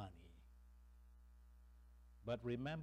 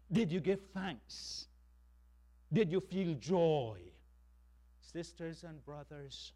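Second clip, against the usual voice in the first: usual speaking voice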